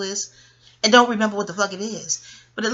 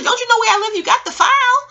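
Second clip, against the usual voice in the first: put-on cheeky kid; 14 semitones higher, 6 dB louder than usual. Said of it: "I'm like".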